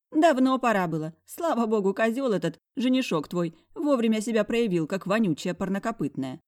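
high, loud scornful friend. Acted. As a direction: spit it out with contempt.

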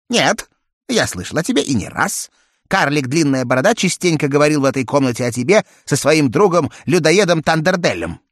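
fast, high chatty bird voice; talking parrot. A narrator's reading. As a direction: rattle it off like a parrot. Попугай отвечает Джеку. (быстрый голос попугая)

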